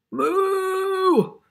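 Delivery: long, high, loud moo; really big moo. Acted. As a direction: moo big like an unhappy cow. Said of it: "A really big moo because this cow is not happy".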